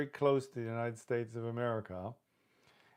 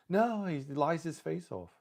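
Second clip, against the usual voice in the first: imitating a friend; 7 semitones higher, 4 dB louder than usual. Voicing Trump voters' answer.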